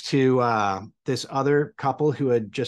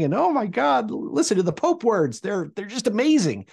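high, gushing thrill; giddy awe; 9 semitones higher than usual. Retold or imitated. Newlywed gushing at the Pope's English, high and thrilled. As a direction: gush like a star-struck fan hearing English at last.

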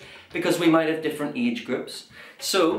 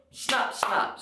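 crocodile snaps; 5 semitones higher, 5 dB quieter than usual. Shown as a demonstration. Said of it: "crocodiles go".

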